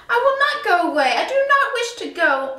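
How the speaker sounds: the cat refusing to leave; the Cat in the Hat. A narrator's reading. The cat refuses the fish's demand, quick and stubborn. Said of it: "said the cat in the hat".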